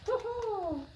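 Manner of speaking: very high gleeful ho-ho; delighted ho-ho. Acted. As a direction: cheer with delight. Joyful exclamation in story.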